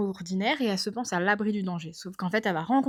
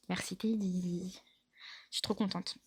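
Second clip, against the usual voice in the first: soft thanks; 10 dB quieter than usual. Real, not acted. Remercie l'ami, doucement.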